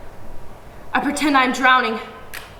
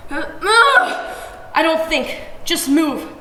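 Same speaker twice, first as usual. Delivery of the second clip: panicked; 6 semitones higher, 3 dB louder, much slower than usual. A narrator's reading. Girl panicking.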